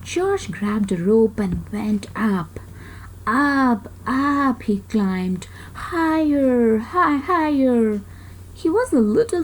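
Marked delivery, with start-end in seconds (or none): none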